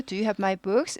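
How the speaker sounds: books lifted at the end; rising question. Shown as a demonstration. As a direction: ask it with the pitch climbing on books. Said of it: "묻는 말이니까 전부". (books에서 끝을 올려 묻기)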